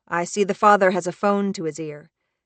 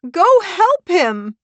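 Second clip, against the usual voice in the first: slow, high, wailing plea; weeping; 13 semitones higher, 10 dB louder, slower than usual. Acted. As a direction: wail the plea, drawing it out. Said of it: "Harper weeps".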